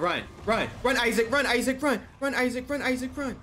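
frantic high screams; panicked yelling. Real, not acted. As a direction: yell run in panic.

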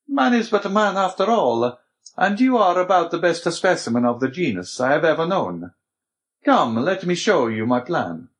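put-on high-pitched, animated old mentor; Abbé, indulgent. A narrator's reading.

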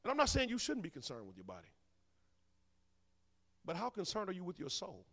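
ordinary talk, nothing marked

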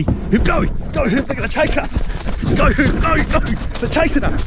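high, loud panicked shouts; excited yelling. Real, not acted. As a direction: yell at your mate to run from the bees. Fleeing stirred-up bees, yelling.

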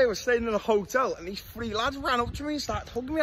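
usual speaking voice

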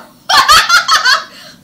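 high, loud hooting laugh; loud demo laugh. Shown as a demonstration. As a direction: show the class hooting. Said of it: "Like that".

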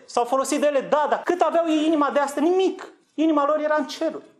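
impassioned preacher exclaiming; fervent outburst. Real, not acted. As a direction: fling out the answer with passion. Rhetorical climax, Nimic!.

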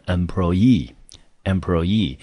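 employee with the last syllable EE stressed; stress on final EE. Shown as a demonstration. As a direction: land the weight on the EE ending of employee. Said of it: "这个字重音就要落在E E这个音节里面".

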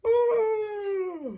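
high loud yell. Shown as a demonstration. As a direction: yell like a dinosaur. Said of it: "does a dinosaur yell".

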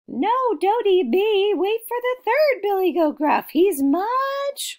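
high, loud pleading goat voice; second billy goat. Acted. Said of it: "the second billy goat Gruff said what?".

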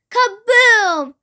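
high, drawn-out storybook boom; big theatrical bang; explosive exclamation. A narrator's reading. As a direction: burst out with the sound of a blast. Narrator voices the explosion.

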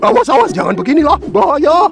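high, hurried panic; frantic, fast cry of caution; alarmed warning. Acted. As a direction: rush it out high as danger closes in. Warns of danger from sword.

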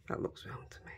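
soft whispered verdict to herself. Real, not acted. Crafter checking the eyes, whispered to herself. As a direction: breathe it out under your breath.

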